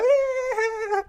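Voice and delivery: high, drawn-out mock puppy squeal; happy dog squeal. Coach mimicking a man thrilled by her call, dog-like squeal. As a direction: squeal softly like an overjoyed little dog. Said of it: "Like a little dog wagging his tail".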